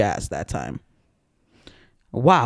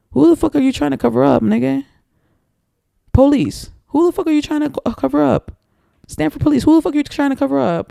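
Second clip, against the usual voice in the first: high, fast, indignant questioning; angry, incredulous, pitched high; heated rant; 11 semitones higher than usual. Real, not acted. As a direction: call out the police, voice climbing. Ranting at police cover-up.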